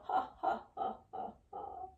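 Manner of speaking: string of breathy ha's, fading out; staccato exhaled ha, tailing away; puffed ha breaths. Shown as a demonstration. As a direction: puff out ha in short pulses.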